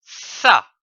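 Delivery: "Ça" spoken slowly and distinctly as a model; clear syllable demo. Shown as a demonstration. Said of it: "C, c'est dit A, ça".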